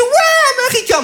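exulter d'une voix très haut perchée; long « Ouais » aigu de foule en liesse; cri d'enthousiasme. Acted. Mime l'enthousiasme d'il y a huit ans. (acclamation joyeuse, très aiguë)

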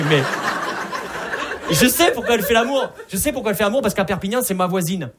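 high, loud comic exasperation; worked-up outburst. Acted. Explaining why he knows about grandma, exasperated outburst. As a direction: burst out with it, pitch way up.

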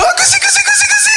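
very high tickle cooing; baby talk tickling. Retold or imitated. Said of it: "Tu lui fais". (chatouilles en voix de bébé, très aiguë)

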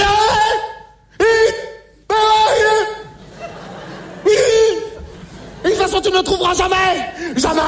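beaucoup plus aigu et fort; bravade criée. Acted. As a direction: narguer l'extraterrestre au téléphone. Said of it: "J'ai dit". (bravade aiguë et criée)

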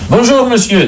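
salut distrait, nettement plus aigu. Acted. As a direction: saluer un inconnu d'un ton distrait, voix haute. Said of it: "dit distraitement le pape". (politesse distraite du pape)

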